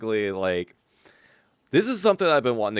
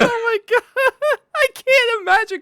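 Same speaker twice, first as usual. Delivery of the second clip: laughing; 5 dB louder than usual. Real, not acted. Cracking up at the story.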